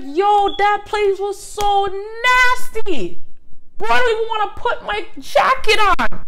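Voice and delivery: high, slow, disgusted squeal; disgusted friend. Retold or imitated. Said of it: "She's like".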